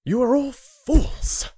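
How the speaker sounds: slow, high, loud cry; furious outburst. Acted. Rin exploding at the laughing council, furious. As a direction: hurl each word at the whole room.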